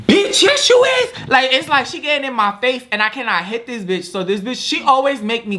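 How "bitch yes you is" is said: high, loud, slow drawn-out accusation; angry retort. Retold or imitated. Roommate in her face, loud slow accusing retort. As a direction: spit it back at her, slow and heated.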